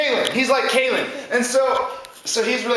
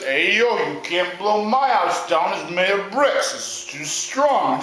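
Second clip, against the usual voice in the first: lower, steady bro voice; unbothered hardworking pig; confident third pig; 3 semitones lower, slower than usual. Acted. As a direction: hold firm in a plodding low voice. Third pig stands firm.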